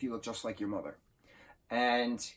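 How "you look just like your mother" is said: softer, moved and tearful; a father seeing his daughter in a dress, softly moved; choked up. Retold or imitated. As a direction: say it quietly, overcome with feeling. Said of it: "He gets so emotional".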